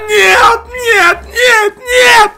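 loud, high, shocked cries; shocked shouting. Real, not acted. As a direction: cry out in disbelief. Shocked by costly skin drop.

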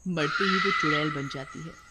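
ordinary delivery

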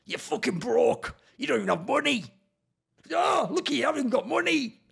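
high mocking taunt, sneering; mocking the troll. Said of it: "his only thing is, like".

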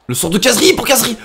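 high, loud burst of live commentary; excited play-by-play. Real, not acted. Gamer following an attack live, excited high shout. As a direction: call the attack as it happens, voice jumping up.

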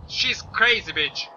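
usual speaking voice

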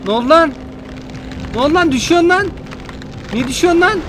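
high, rising panicked questions; alarmed surprise. Real, not acted. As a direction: panic as it falls. Ship falling, panic. (telaşlı şaşkınlık)

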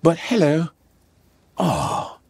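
mock suave British accent. Idris back to his normal self, suave British accent. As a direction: put on a smooth British voice. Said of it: "we get to hear James Bond".

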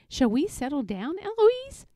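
cheeky echo, name swooping up high; mimicking the tutor. A narrator's reading. Eloise copying her tutor, cheeky and rising. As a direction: parrot it back with a rising lilt. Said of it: "And I say".

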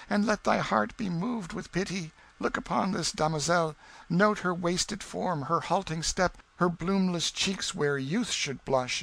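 high damsel in distress; melodramatic pleading. A narrator's reading. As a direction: beg for rescue in a high, theatrical voice. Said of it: "she cried".

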